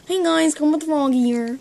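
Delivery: Kermit the Frog. Acted. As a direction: announce yourself like a puppet frog. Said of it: "Now we're gonna do Kermit the Frog".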